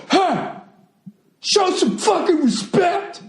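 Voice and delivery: high, strained heckler shout; mock heckler yell. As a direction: mimic the angry heckler yelling. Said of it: "He starts yelling at me".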